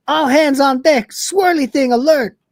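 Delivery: high-pitched mock alert; acted alarm call. Cat rallies the crew to the alert, high mock alarm.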